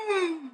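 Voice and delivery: high, soft "mm"; savoring hum. Real, not acted. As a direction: hum softly up high in appreciation. Savoring the song.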